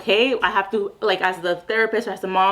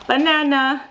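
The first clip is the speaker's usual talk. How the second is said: higher, louder, bright announcement; higher and louder excited label; excited naming; 6 semitones higher, 4 dB louder, slower than usual. Shown as a demonstration. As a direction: sell the banana with a little gasp of delight. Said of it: "you can peel it and be like".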